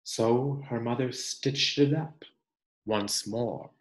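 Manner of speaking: gentle, expressive picture-book reading; reading to children. Acted. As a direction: read it like a storybook for the class.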